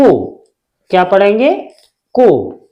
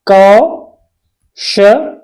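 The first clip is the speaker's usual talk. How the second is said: Kaushal very slowly, as "kau... sha... la" with long pauses; syllable by syllable; much slower than usual. Shown as a demonstration. Teacher spelling out for students, very slow broken-up Kaushal. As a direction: draw out each syllable of Kaushal separately.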